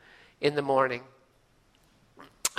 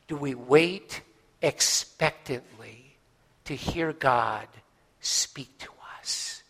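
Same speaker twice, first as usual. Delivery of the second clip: slow hushed question; 4 semitones lower, 4 dB quieter, slower than usual. Real, not acted.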